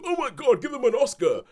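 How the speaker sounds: mock gushing shout, high and loud; hammed-up fan screaming for an award; gushing mock cheer. Critics overpraising a star, in mock gushing shout. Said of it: "And they go".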